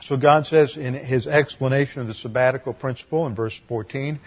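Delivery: ordinary delivery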